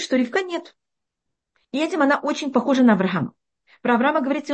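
usual speaking voice

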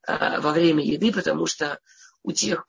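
ordinary talk, nothing marked

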